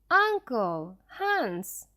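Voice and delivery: mild call for the lost companions; shouted names. Acted. Lost boy calling for his uncle and guide, a mild shout. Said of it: "I shouted their names".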